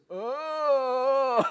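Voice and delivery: high, loud mock moan of agony; loud groan. Heroin addict moaning down the phone, hammed-up groan. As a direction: wail out a drawn-out "oh", hammed up.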